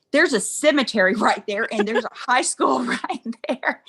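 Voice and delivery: laughing through the words. Retold or imitated. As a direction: giggle as you point it out. Pointing out proposal location.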